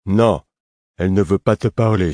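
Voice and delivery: loud, stubborn snub; curt defiance. A narrator's reading. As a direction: shut the questioner down childishly. Lourd snubs the narrator.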